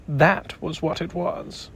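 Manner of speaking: usual speaking voice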